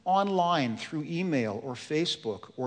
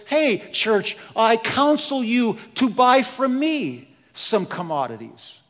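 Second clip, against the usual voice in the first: loud, raised preaching voice as Jesus; lightly voiced Jesus, bold and urging; Jesus counsels the church; 4 semitones higher, 6 dB louder than usual. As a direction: boom the Lord's counsel across the marketplace. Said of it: "and he says".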